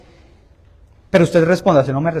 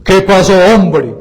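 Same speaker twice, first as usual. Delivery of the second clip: louder, forceful imitation of a priest; harder priest imitation; 7 dB louder than usual. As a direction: punch it out hard, mimicking the priest. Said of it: "No, no, más duro".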